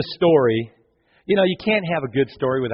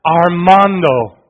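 loud, slow cry of a name; father crying out; 3 semitones higher, 9 dB louder, much slower than usual. Retold or imitated. Father finding his son in the rubble calls out his name, loud and slow. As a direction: draw the name out in a loud call. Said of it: "And the dad cried".

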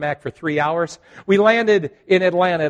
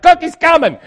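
much higher, louder eager reply; cheery call back; 12 semitones higher, 11 dB louder than usual. Retold or imitated. Susan calls back across the plane to the pastor, bright and excited. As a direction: sing out that cookies are on the way.

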